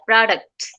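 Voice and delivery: প্রোডাক্টস with the t swallowed; প্রোডাক্টস as pro-dux, t dropped; American pronunciation. Shown as a demonstration. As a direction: say প্রোডাক্টস quickly, no t. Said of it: "এইভাবে বলে".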